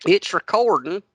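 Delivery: high, loud hillbilly twang mimicking him; teasing country-accent echo; mock Southern accent. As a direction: echo his words in an exaggerated Southern drawl. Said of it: "You making fun of my accent".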